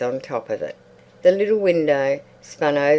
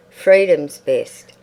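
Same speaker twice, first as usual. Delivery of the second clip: slow and louder, "freedom's" stressed with a falling close; Mrs Stubbs, surprising; 8 dB louder, slower than usual. A narrator's reading. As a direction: lean on "freedom's" slowly as plump Mrs Stubbs. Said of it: "she said surprisingly".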